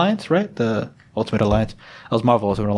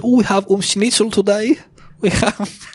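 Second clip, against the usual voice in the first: high put-on German accent; 10 semitones higher, slower than usual. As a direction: play the father in a German accent. Voicing Hitler's father.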